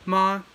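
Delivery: ma high and level; high level Mandarin tone on ma; first tone ma. Shown as a demonstration. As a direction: keep ma high and flat. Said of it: "high flat tone".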